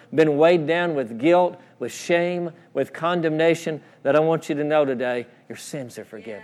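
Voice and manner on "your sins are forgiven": very hushed, low reassurance; gentle assurance. Real, not acted. Preacher to those weighed down with guilt, hushed assurance. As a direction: drop almost to nothing, low and tender.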